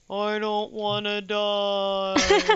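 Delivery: slow, drawn-out whimpering undead plea; sad zombie voice. Retold or imitated. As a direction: moan it out like a sad little zombie. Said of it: "In, like, a super sad little, little zombie voice".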